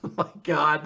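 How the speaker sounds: normal talking voice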